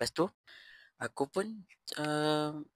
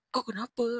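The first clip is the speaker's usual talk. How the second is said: sharp, much higher snap; retold angry what's-your-problem; angry retort; 10 semitones higher than usual. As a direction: confront him, fed up. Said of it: "Lepas tu aku marah".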